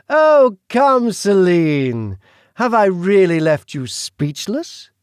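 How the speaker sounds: high, slow, theatrical British aristocrat; grand drawn-out British villain voice; British accent. Acted. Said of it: "His British accent".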